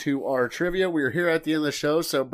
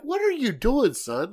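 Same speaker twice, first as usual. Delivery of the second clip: pitched-up disbelief at son; mock outraged what are you doing; incredulous dad; 9 semitones higher, slower than usual. Dad reacting to son's demands.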